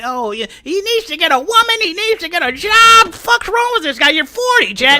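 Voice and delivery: high sneering mock of online critics. S0 mocking critics to S1, in a high sneering voice.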